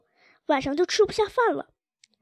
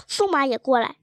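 plain reading voice

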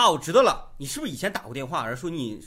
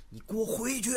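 a higher stern command to go back; stern dead husband; 10 semitones higher than usual. Acted. Dead husband orders his wife to go back, stern. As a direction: order her back from the underworld. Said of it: "他说".

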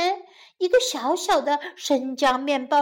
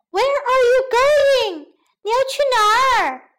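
calling after someone; 8 semitones higher, 4 dB louder than usual. A narrator's reading. Woman chases gingerbread man, calling out loudly. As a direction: shout after the runaway.

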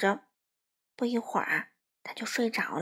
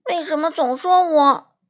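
higher, much louder wronged wail; aggrieved protest; 5 semitones higher, 9 dB louder than usual. A narrator's reading. Crow protesting to the mother, loud aggrieved wail. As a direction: cry out at the unfairness. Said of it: "花袜子觉得很委屈".

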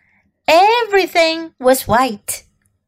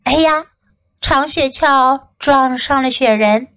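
ordinary delivery

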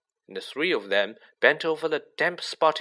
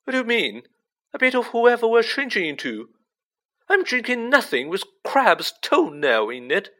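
much higher, louder sharp protest; sharp outrage; 11 semitones higher, 6 dB louder than usual. A narrator's reading. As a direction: snap back in disgusted disbelief. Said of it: "said Ron sharply".

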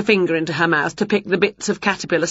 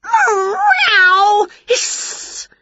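loud, high cat yowl into a hiss; stung cat's screech and spit; 5 dB louder than usual. A narrator's reading. Cat sits on stinging nettles.

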